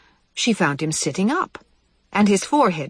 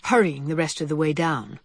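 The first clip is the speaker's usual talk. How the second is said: ordinary delivery, nothing marked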